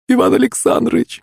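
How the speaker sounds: very high, rising female cry; woman's desperate plea. A narrator's reading. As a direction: call out imploringly to stop him. Pyshka pleads with master. (умоляющий женский вскрик)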